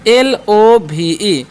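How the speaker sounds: slow, each letter apart: एल, ओ, वी, ई; spelled out letter by letter. Shown as a demonstration. Teacher spelling the word slowly to the class. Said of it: "एल का उच्चारण हम क्या करेंगे".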